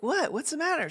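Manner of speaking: high-pitched innocent act; put-on puzzlement, pitched well up; feigned just-woken confusion. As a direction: play dumb, as if just woken. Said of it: "like she had woken me up".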